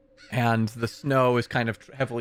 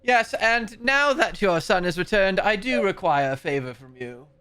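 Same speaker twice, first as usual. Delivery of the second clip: abbot voice; 7 semitones higher than usual. Acted. Abbot demands favor.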